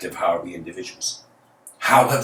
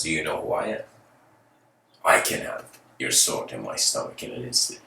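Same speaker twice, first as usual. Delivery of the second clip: low, softly measured reply; hint of an unafraid monk, voice sinking low; fearless monk; 5 semitones lower, 3 dB quieter, faster than usual. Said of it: "sees the fearlessness".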